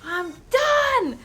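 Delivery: strained mock screaming tirade; screaming meltdown. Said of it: "and I'm screaming".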